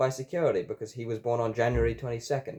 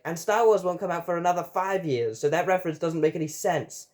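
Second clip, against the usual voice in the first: high-pitched, pedantic protest; protesting objection; 7 semitones higher than usual. A narrator's reading. Everyone objects to the Star Wars reference, protesting. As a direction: object in a raised, put-out voice.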